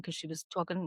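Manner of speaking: ordinary talk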